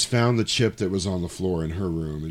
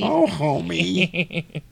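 high, drawn-out doting wife voice; Marge Simpson; 8 semitones higher, much slower than usual. Acted. Joking as Homer's wife, cartoon impression. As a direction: stretch it out, high and laughing. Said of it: "you know, Homer's wife".

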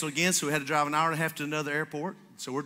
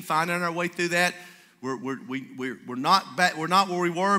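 usual speaking voice

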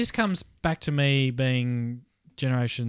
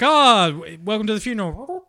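high-pitched mock greeting; mock welcome; 7 semitones higher than usual. Podcaster mocking the film's funeral music, put-on greeting. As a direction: welcome them in a put-on higher voice.

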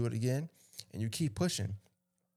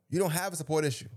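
quick, higher-pitched insistence; emphatic repeat; 5 semitones higher, faster than usual. Real, not acted. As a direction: drive the point home fast and high. Repeats the post line for emphasis.

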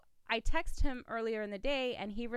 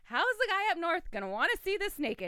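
high, loud comic self-mocking rant; frantic phone rant; 8 semitones higher, 5 dB louder than usual. Woman venting to who she thinks is John, frantic rant. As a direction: wail it in mock despair, pitched way up.

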